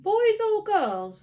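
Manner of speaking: high, loud, slow evasive question; boy stalling an awkward question. A narrator's reading. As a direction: drag it out to stall. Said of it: "still stalling".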